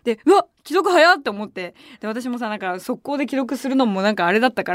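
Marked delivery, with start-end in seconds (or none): 0.2-1.2 surprised. Retold or imitated. Instant read receipt surprise.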